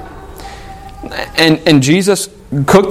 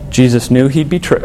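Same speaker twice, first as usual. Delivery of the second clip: normal talking voice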